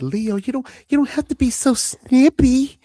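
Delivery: high, pleading scolding with pauses on each phrase; Faye from What About Bob. Acted. Host quotes movie wife scolding Leo for being snippy, high chiding voice. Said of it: "in What About Bob, as Faye said".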